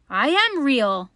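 slow, high, loud little rabbit; emphatic storybook plea to be believed; insistent protest. A narrator's reading. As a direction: insist on it, drawing the words out. Little rabbit protests, nearly crying.

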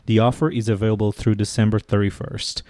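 usual speaking voice

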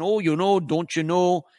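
lightly imitated Midwestern accent; a quick sample of Midwestern accent. Shown as a demonstration. Showing listeners a regional accent, mock Midwestern. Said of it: "Midwestern accent".

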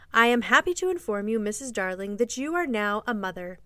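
ordinary delivery

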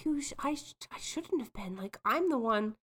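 a hushed, earnest mock confession; soft confession. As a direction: quietly own up to the jealousy. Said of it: "Sam being like".

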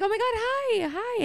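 very high, bubbly mock gush; hammed-up sweet hello to a boyfriend's mom; overly polite squeal meeting his mother; gushing polite greeting. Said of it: "I'm gonna be like".

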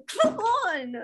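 high, drawn-out mock exasperation; slow, high-pitched hammed-up protest; mock tantrum. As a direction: whine it like stamping your foot. Acts out foot-stomping tantrum.

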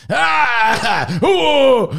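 high comic yelps; hammed-up frightened cries; mock scream. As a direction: yelp in pretend panic. Acting out panic with broomstick.

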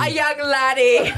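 a quick mock Irish accent on laddie; slow, playful Irish lilt. Joking about not being a young boy, mock Irish accent. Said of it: "You're Irish?".

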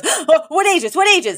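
high, eager repeated question; eager brother. Retold or imitated. Brother hearing about boarding school, eager and high. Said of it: "Brighton instantly goes".

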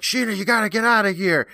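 high-pitched urgent warning; mock desperation. Co-host as uncle dragged into the ground, mock desperation. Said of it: "and he's like".

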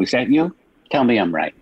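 ordinary delivery

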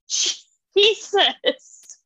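high, shocked Jesus; a high, stunned cry at something brutal; shocked exclamation. Retold or imitated. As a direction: gasp it out in disbelief. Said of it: "And I'm like".

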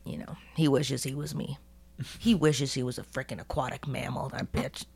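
low, secretive bad-mouthing; hushed gossip. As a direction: lean in and whisper the gossip. Gossiping about rival Paul.

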